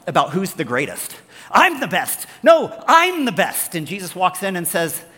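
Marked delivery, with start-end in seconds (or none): 1.5-3.8 put-on high, loud childish squabble; bickering disciples. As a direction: squabble like boastful kids, slowing the second line. Disciples arguing who's the greatest.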